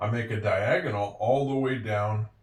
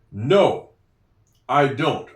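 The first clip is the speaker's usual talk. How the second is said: No, then a clear pause before I don't; louder No, then pause, then I don't; pause after no; 5 dB louder, much slower than usual. Shown as a demonstration. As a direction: hold a beat after No. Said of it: "there's a little pause".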